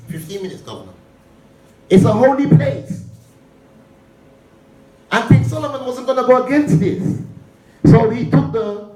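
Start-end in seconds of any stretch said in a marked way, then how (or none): 1.9-8.9 high-pitched, emphatic pulpit delivery; impassioned preaching. Real, not acted. Preacher telling the congregation of a holy place, impassioned. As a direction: press the point home with a raised pitch.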